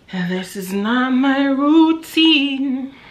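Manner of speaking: high and slow, stretching routine; slow high drawn-out. Shown as a demonstration.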